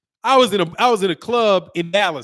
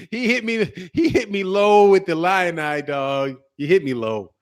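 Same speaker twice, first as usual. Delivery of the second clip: ordinary talk, nothing marked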